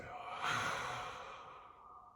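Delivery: big, audible breath out; exhaling sigh. Shown as a demonstration. Guide demonstrating the exhale to listeners, long releasing sigh. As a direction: sigh it all out as the shoulders drop. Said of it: "drop your shoulders and exhale".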